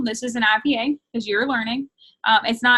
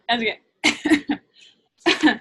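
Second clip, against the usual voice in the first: slow, laughing through the words; drawn out with a real laugh; 3 dB louder, much slower than usual. Real, not acted. Reassures, breaks into laughter.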